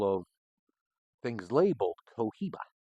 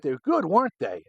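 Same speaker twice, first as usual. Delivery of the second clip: mock coworker; 8 semitones higher, 8 dB louder than usual. Coworkers asking about cigars.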